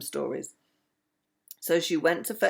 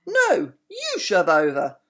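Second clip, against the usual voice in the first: a second little raccoon voice, louder, snapping back; bickering twin; 6 dB louder, slower than usual. Acted. Raccoon twin snapping back at his brother, louder kid voice. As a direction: argue right back at your twin, slowly.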